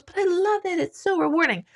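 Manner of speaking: high, sing-song gushing about motherhood; mock gushing. Mocking other mothers who say they love it, in a high sing-song. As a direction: parrot the cheery party line. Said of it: "Everyone goes".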